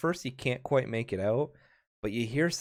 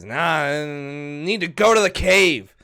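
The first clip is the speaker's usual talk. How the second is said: put-on slow, louder drunk insistence; drunken grumble; 6 semitones higher, 11 dB louder than usual. Drunk patron insisting to the barkeep, slow drunken grumble. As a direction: grumble it, stubborn and sloshed. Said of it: "he grumbles some more, a little upset".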